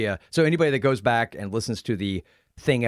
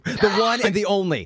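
mock grand intro; 7 semitones higher than usual. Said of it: "I've introduced virtually everybody the same".

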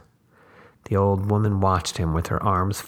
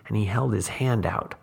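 ordinary delivery

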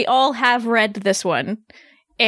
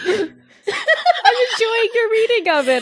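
high, slow, laughing; amused laughing; 9 semitones higher, slower than usual. Real, not acted. Co-host telling the author she enjoys it, laughing. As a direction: savour it through laughter. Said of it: "It makes me laugh".

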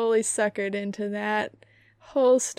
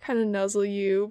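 ordinary talk, nothing marked

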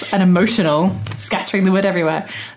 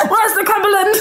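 high-pitched quote, cracking up; laughing through the sausage's line. Acted. Retelling the Grimm tale to co-hosts, laughing the sausage's line. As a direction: voice the sausage while giggling.

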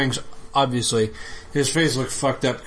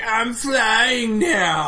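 goofy character voice; 11 semitones higher, 3 dB louder, much slower than usual. Retold or imitated. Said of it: "He's like".

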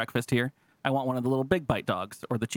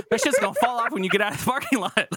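higher and louder, laughing through the words; laughing disbelief; 8 semitones higher, 5 dB louder than usual. Retold or imitated. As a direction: scoff at her naivety while cracking up. Mocking girlfriend's naive trust.

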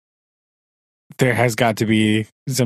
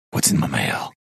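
low mock mother-in-law; 13 semitones lower, 3 dB quieter than usual. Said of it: "mimicking mother-in-law".

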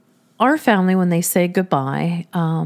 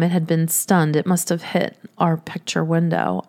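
normal talking voice

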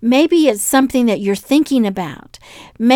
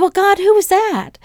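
curious self; 9 semitones higher than usual. Retold or imitated. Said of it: "I said".

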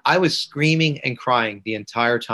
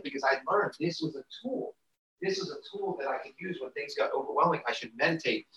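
very faint, distant voice; much softer, as if off-mic; away from the mic; 12 dB quieter than usual. Real, not acted. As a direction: talk while walking away from the mic. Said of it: "we can't hear you as well".

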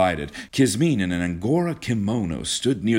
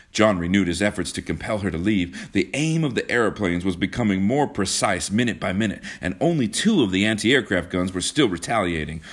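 plain reading voice

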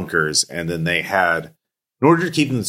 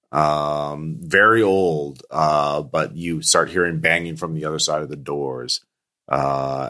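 ordinary talk, nothing marked

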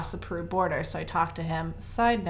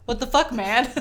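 louder, higher mock outrage; exasperated outburst; 4 semitones higher, 8 dB louder than usual. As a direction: call out a bystander in retold exasperation. Both exasperated at silent English speaker.

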